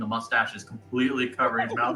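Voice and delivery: normal talking voice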